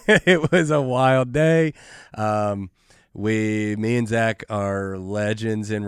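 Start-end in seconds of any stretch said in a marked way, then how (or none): 0.0-1.7 louder, slow, laughing through the words. Real, not acted. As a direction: laugh it out recalling the wild day. Laughing at the fight story.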